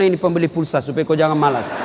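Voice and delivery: low, quick, good-natured handout; a generous boss teasing gently; kind boss voice. Retold or imitated. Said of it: "Apalagi kalau langsung dia".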